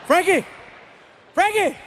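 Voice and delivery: calling Frankie high to wake him; yelling his name. Retold or imitated. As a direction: call the son out of sleep. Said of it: "And I yell".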